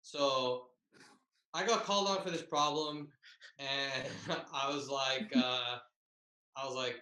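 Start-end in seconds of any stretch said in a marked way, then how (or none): none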